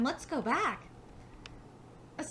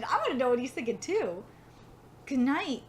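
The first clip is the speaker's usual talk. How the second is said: ordinary delivery, nothing marked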